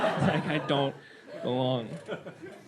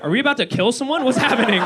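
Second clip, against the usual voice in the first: alarmed; 7 semitones higher, 5 dB louder than usual. Retold or imitated. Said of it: "I was like".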